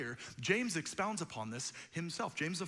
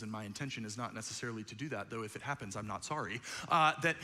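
ordinary delivery

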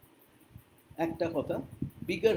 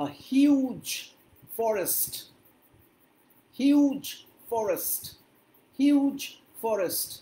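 "huge" loud, high and drawn out; emphasis on huge; 4 semitones higher, 4 dB louder, much slower than usual. Shown as a demonstration. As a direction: punch huge, then drop to usual on forest. Teacher models phrase, stressing huge. ("huge" জোরে, টেনে, উঁচু সুরে)

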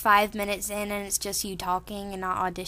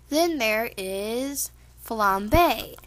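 ordinary talk

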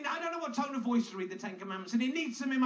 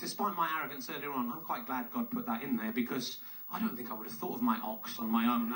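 normal talking voice

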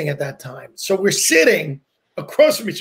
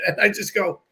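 normal talking voice

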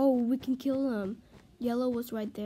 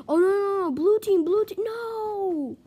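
high, loud cries of alarm; panicked outcry; 9 semitones higher, 8 dB louder than usual. Real, not acted. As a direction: gasp and wail as disaster hits. Enemy team attacks their bed.

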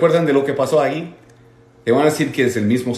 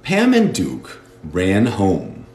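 slow model; 2 semitones lower, much slower than usual. Shown as a demonstration. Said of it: "teacher models slowly".

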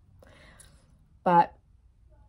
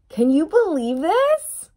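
loud, high, slow disbelief; astonished; 7 semitones higher, 6 dB louder, slower than usual. Real, not acted. Reader stunned by the reading to viewers, loud astonished cry. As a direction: let amazement burst out. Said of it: "mind totally blown".

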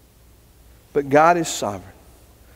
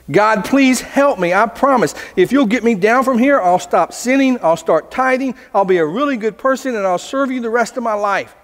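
higher-pitched, acted bargaining plea; desperate pleading; 6 semitones higher than usual. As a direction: plead your way out of danger. Hanging man bargains with God.

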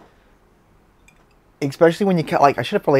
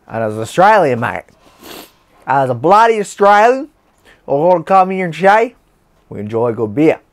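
louder and higher on "bloody Australian"; proud Aussie boast; 3 semitones higher, 4 dB louder than usual. Acted. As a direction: pump it up like a patriotic mate.

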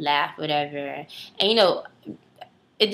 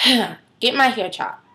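ordinary delivery

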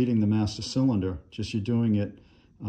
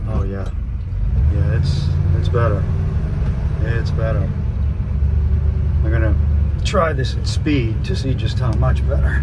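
in-car recording; 9 dB louder than usual. Real, not acted. Driving test, recorded in moving car.

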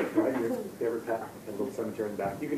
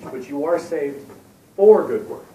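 "for" punched much louder and higher, "saved" slowed; stress on FOR; 3 semitones higher, 9 dB louder than usual. Shown as a demonstration. Preacher correcting wrong teaching, punching "for". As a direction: land hard on "for good works". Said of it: "when you stress that word".

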